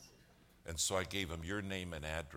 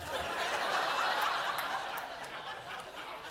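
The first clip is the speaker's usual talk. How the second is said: a big open laugh at his own joke; hearty laugh; 6 dB louder than usual. Real, not acted. Preacher laughing at his Cleveland punchline, real hearty laugh. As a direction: laugh out loud, delighted.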